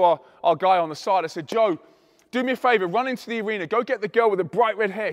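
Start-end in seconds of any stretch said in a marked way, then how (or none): 1.5-5.1 raised, higher voice calling to a helper; louder, pressing instructions; urgent request. Retold or imitated. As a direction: send someone off on an errand. Sending stage helper into the crowd.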